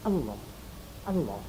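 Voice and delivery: softly prompting a dying man; whispered. Shown as a demonstration. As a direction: whisper Allah again and again into the ear. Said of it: "Bacakan saja Allah".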